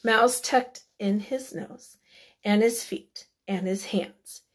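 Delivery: usual speaking voice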